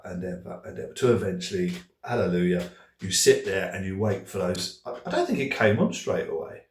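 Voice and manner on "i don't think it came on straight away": ordinary talk, nothing marked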